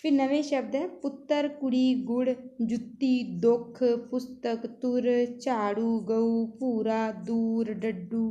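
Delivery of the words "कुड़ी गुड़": ordinary talk, nothing marked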